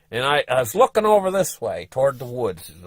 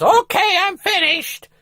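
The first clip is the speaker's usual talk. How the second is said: high, chirpy wife voice; wife calling out, pitched high; relieved wife; 5 dB louder, slower than usual. Retold or imitated. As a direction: announce you're done.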